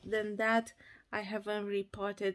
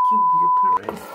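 shrieked high and loud at the city; hammed-up exasperated scream; mock outburst; 15 dB louder, much faster than usual. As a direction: yell it out in comic outrage. Yelling at the city.

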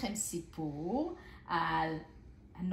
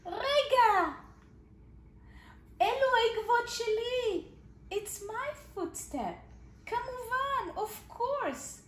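surprised realisation; 13 semitones higher, slower than usual. Acted. Elad finds the footprints are his own, high and surprised. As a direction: gasp it out like a gnome catching himself.

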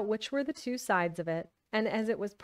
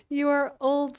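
youth's voice; 6 semitones higher, 7 dB louder than usual. Acted. Rereads line after laughing.

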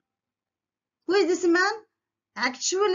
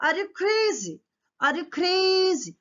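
high-pitched challenging question; incredulous interrogation; 9 semitones higher than usual. Acted. King questions whether the carpenter can cook, interrogating. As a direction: fire it out high, stretching the repeat. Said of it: "What he interrogate అమ్మా?".

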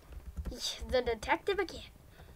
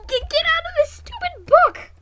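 high, loud, fed-up cry; frustrated yell; 13 semitones higher, 9 dB louder than usual. Real, not acted. Gamer yelling at the stuck game book. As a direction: shout at the stuck menu.

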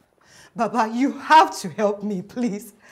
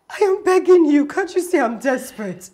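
high, loud desperate begging; desperate plea; 4 semitones higher, 3 dB louder than usual. Acted. Desperate woman begging the herbalist, loud and high. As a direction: beg him with everything you have.

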